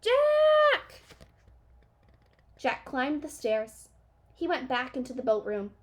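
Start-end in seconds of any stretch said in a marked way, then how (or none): 0.0-1.0 very high, loud frightened cry; loud panicked cries; cry for help. A narrator's reading. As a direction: call for help from far away. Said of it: "Her voice was louder".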